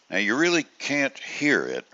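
usual speaking voice

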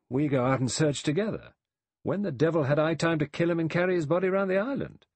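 indignant self-defence, rising in pitch; sharp retort. A narrator's reading. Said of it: "Lombard said sharply".

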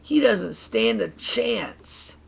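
slow, high-pitched taunt; storyteller's scornful racer; snapping. A narrator's reading. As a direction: draw out the put-down. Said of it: "snapped Stinky".

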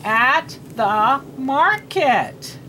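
"at the market" one word at a time; very slow, spaced-out "at the market"; slow read-aloud. Shown as a demonstration. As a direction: read "at the market" slowly, separating each word. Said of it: "we're gonna read".

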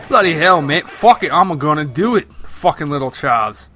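a loud, hammed-up British accent, badly faked; lightly mock the buddy's British voice; poorly done UK accent. Gamer buddy quoted in a poorly done UK accent. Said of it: "just trying to be funny with a U K poorly done accent".

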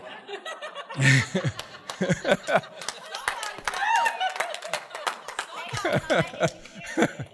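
long high-pitched laugh; helpless laughing fit. Real, not acted. Preacher loses it over grandkids' picture, long high laughter. Breaks up laughing mid-sentence.

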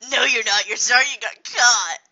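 loud bitter snap back; angry retort. Acted. Loud angry retort to a fake apology. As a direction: throw the apology back at her. Acted quarrel continues.

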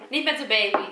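ordinary talk, nothing marked

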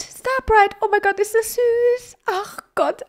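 very high, melting coo; drawn-out high-pitched gush of adoration; cuteness gushing. Real, not acted. Gushing over the cute foal's name.

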